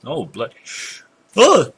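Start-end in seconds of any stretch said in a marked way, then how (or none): 1.4-1.7 high, loud words pushed through a yawn; sudden yawn. Real, not acted. Gamer apologizing to viewers as a yawn sneaks up, yawning. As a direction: excuse yourself mid-yawn, pitch leaping up. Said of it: "that one snuck up on me".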